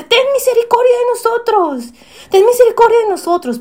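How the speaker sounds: high, loud desperate plea; desperate beggars crying out loud. Retold or imitated. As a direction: shout the plea for mercy over the crowd. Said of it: "comenzaron mucho más fuerte".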